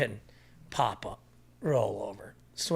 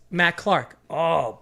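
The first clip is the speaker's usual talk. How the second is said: ordinary talk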